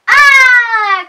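storybook scream of terror; terrified scream. Acted. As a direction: shriek in fright at the monster pumpkins. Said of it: "cried the crocodile".

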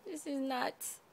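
usual speaking voice

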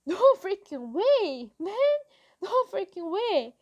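high, slightly loud exclamation of shock; shocked disbelief. A narrator's reading. Boy reacting to a face, shocked exclaiming.

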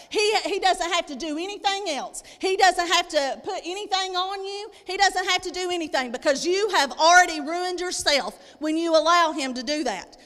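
ordinary talk

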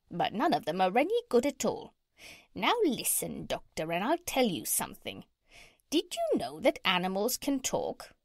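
knowing parrot with swooping pitch. Acted. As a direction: confide in the doctor in a squawky parrot voice.

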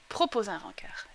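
whispered instructions to herself; whispering. Real, not acted. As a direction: whisper while concentrating. Whispering while clicking.

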